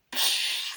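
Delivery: voiceless whooshing blast; imitated flamethrower. Retelling the flamethrower firing, imitated whoosh. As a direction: blow out a burst of fire noise.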